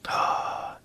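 soft, breathy gasp of awe; hushed gasp. Real, not acted. Host reacts to the Paimon offer, hushed gasp. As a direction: gasp under your breath.

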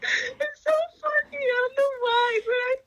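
high, giggly talk through real laughter; can't stop laughing while telling it; laughing fit. Real, not acted. Giggling fit while retelling scene.

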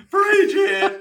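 high, loud, laughing holler; hammed-up Southern shout; mock church shout. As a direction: whoop it like a country congregation.

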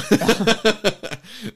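real high-pitched laughing burst; high cackling laugh. Real, not acted. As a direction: keep cracking up. Cracks up at the joke.

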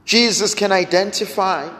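normal talking voice

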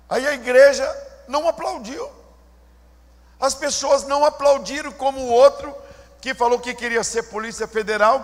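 usual speaking voice

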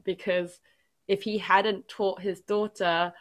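ordinary talk, nothing marked

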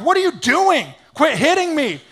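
high-pitched, acted indignant squawk; protesting donkey. Donkey protesting to Balaam over the beating, hammed-up indignation. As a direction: complain loudly as a wronged talking donkey.

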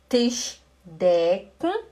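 Tisch decken spelled out slowly; slow demo. Shown as a demonstration. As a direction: say Tisch decken very slowly, one syllable at a time. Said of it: "pronunciation demo".

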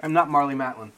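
thick tongue lisp. Real, not acted. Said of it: "You sound like a deaf person".

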